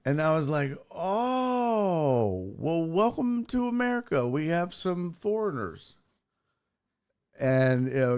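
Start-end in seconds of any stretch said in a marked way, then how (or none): 0.9-6.0 high, drawn-out "oh" of mock amazement; mock grand welcome. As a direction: greet them like exotic visitors. Mock greeting to Louisiana "foreigners.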